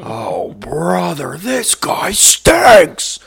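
high, very slow, loud yell; loud cartoon yell. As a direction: bellow it like a cartoon villain. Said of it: "It's my favorite line".

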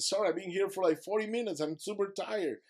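high-pitched pleading excuse; protesting apology. Retold or imitated. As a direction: plead it up high, reenacting exhaustion. Pleading back to screaming boatman, retold.

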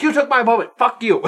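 loud, high-pitched petulant protest; hammed-up wronged brother; mock outrage. Retelling the brother's complaint to his friend, loud mock outrage.

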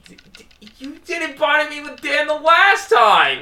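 loud, high protest; indignant shout. Real, not acted. Protesting loudly.

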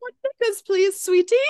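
put-on very high, sugary British accent; a proper little Brit pleading in a British accent. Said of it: "So I'm gonna say it in an accent".

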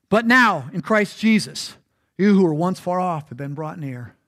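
measured reading from the Bible; scripture reading. Acted. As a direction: read the verse deliberately. Reads scripture aloud.

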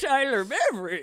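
high-pitched mock impression; hammed-up high caricature of a friend; Tyler Beveridge. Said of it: "that was my Tyler impression".

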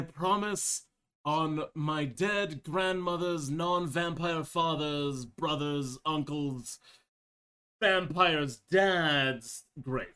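drawn out slowly, mock solemn; slow solemn oath. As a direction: stretch each word like a vow.

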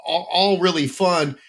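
ordinary talk

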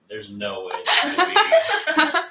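high, loud laugh through the words; incredulous, slow and laughing; laughing disbelief. Retold or imitated. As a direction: crack up while retelling your disbelief. Laughing at scammer's implausible name.

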